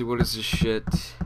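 normal talking voice

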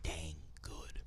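breathy hushed whisper, slow; whispered aside. Real, not acted. Host whispers his praise of Rock and Roman to co-host, slow whisper. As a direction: lean in and whisper it like a secret.

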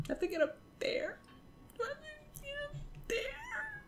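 very soft, climbing to a high squeak; faint, squeaky dismay; tiny disbelieving voice. Real, not acted. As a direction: shrink the question to a tiny high peep. Repeats the dreaded question to self.

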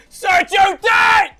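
high, slow, very loud bellow; excited shout. Real, not acted. Gamer hailing Sergio Dest on the ball, excited shout. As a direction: bellow it, stretching each word. Shouting as his player attacks.

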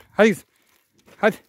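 loud high call to a cow. Real, not acted. Calling the cow along.

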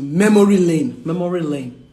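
"memory lane" said with the R and L clearly separated; careful L and R. Shown as a demonstration. Speaker retrying after tripping on "memory lane", careful articulation. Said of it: "what's it with the L and R?".

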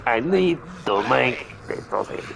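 low mock Bulgarian accent; Bulgarian-sounding accent. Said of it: "that's the original one you did".

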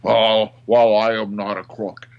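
loud, jowly politician impression; Nixon. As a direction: growl out a well-known president's denial. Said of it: "could do a Nixon impression".

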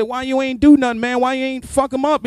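loud, high protest; incredulous scolding. Retold or imitated. Housemate asks why he didn't fight, loud mock outrage. Scolding his robbed roommate.

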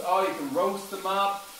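usual speaking voice

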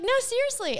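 high, pressing insistence; insistent friend. Retold or imitated. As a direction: push the question hard. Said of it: "You're like".

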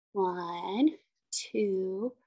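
ordinary talk, nothing marked

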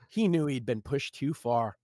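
ordinary talk

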